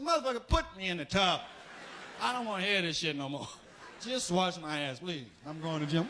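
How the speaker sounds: fast, impatient snapping; fed-up demand. Retold or imitated. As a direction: rush it out, sick of talking. Patient fed up with talk.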